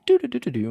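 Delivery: high sung beeping chime; imitated Alexa chime. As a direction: mimic the speaker's start-up jingle.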